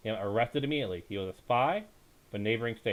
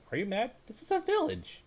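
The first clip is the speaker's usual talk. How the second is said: high, disbelieving outburst; incredulous retort; 13 semitones higher than usual. Acted. Rahman, baffled by Hussein, answers in high disbelief. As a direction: scoff at him as if he's lost his mind.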